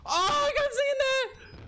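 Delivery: high, drawn-out panicked cry; real high-pitched scare; startled scream. Real, not acted. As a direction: cry out in sudden fright. Jump scare in horror game.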